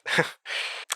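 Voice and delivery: short breathy laugh; soft airless chuckle in the dark. A narrator's reading. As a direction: let out an airy little laugh. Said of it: "I laughed".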